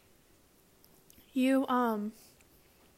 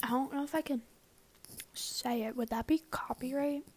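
whispering; 8 dB quieter than usual. Real, not acted. Unsure if she can say it.

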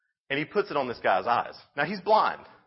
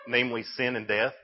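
ordinary delivery

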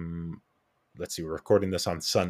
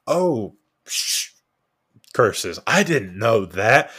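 mock dismay; 3 semitones higher, 7 dB louder, slower than usual. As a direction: play the patient busted by the husband news. Patient retold, caught out flirting.

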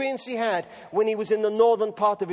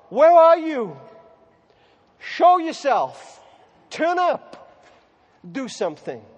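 crying out to God; 5 dB louder, slower than usual. Acted.

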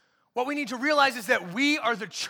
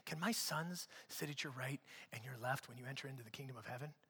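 much softer, lower, hushed request; mom asking softly; 10 semitones lower, 19 dB quieter than usual. Retold or imitated. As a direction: ask the favour almost under your breath. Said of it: "A mom came to Jesus and was like".

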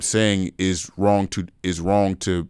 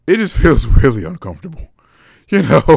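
laughing through the words, higher and louder; awkward laughter; 7 semitones higher, 6 dB louder than usual. Real, not acted. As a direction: let a laugh break into the admission. Awkward laugh while admitting discomfort.

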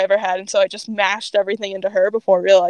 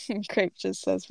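usual speaking voice

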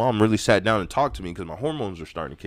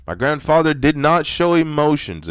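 getting loud; 6 semitones higher, 5 dB louder than usual. Real, not acted. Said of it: "Sorry if I'm getting loud".

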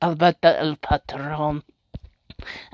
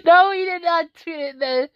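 stunned disbelief; 9 semitones higher than usual. Real, not acted. Disbelief at the tweet.

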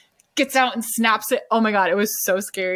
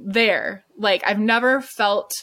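ordinary talk

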